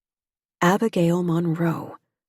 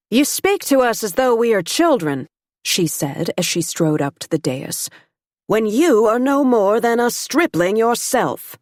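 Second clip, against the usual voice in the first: a bold woman calling across the room, higher and a bit louder; forceful society woman; 5 semitones higher, 4 dB louder than usual. Acted. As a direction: challenge him like a proud lady. Abigail confronts Duchamp, raised.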